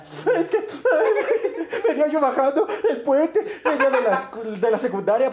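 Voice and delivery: high-pitched mock wail through laughter; lloriqueo imitado. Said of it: "empecé a chillar". (llanto fingido, agudo y entre risas)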